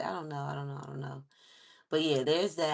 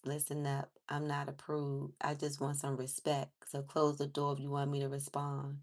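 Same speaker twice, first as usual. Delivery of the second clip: steady, even reading of the lyrics, lower; 3 semitones lower than usual. Acted. Reads lyrics off screen.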